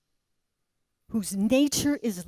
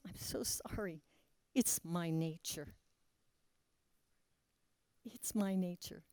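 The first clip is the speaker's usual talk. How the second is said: hushed apology; 4 semitones lower, 13 dB quieter than usual. Acted.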